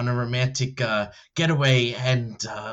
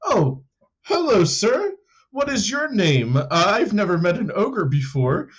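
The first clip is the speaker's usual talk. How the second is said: polite stranger meeting an ogre; 5 semitones higher than usual. Retold or imitated. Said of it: "It'd be like".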